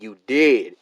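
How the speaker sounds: "You did" punched hard and loud; shout-read "You did" as typed in caps; all-caps emphasis. Shown as a demonstration. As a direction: hit "did" like it's in capitals. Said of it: "I'm gonna emphasize every time she capitalized a word".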